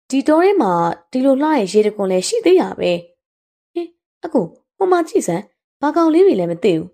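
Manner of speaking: put-on lively, high girlish exclaiming; excited wonder. Girl pointing out animals to companion, excited. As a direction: call him over in delight.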